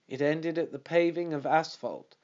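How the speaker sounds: ordinary delivery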